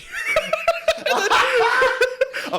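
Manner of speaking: high loud howl of laughter; big laugh. Real, not acted. Comic laughing at being caught with fake whiskey, loud howl. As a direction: lose it laughing.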